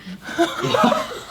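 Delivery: high giggle. Real, not acted. Laughs at the transition.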